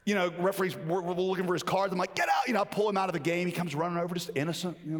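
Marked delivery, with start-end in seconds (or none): 2.1-2.5 angry shout. Retold or imitated. Coach yanks player from game.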